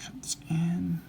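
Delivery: breathy whispered mumble; mumbling. Real, not acted. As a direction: murmur it under breath. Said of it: "Forgive me if I'm mumbling".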